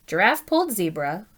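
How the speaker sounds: usual speaking voice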